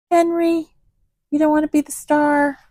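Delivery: high sing-song pet talk; talking to the cat. Real, not acted. Coaxing the cat on camera.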